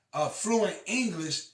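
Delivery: usual speaking voice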